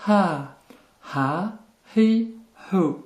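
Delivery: "Ha, hi, hu" each opened by a slight puff; just puff the H on "Ha, hi, hu", no scraping; lightly blown H. Shown as a demonstration. Teacher demonstrating the letter, lightly blown H. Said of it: "c'est un H très légèrement soufflé".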